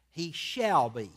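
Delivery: slow, punched SHALL; stress on SHALL BE. Real, not acted. Preacher ruling out if or maybe, stress on SHALL BE. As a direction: draw out shall be as a certainty. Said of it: "underline those two words".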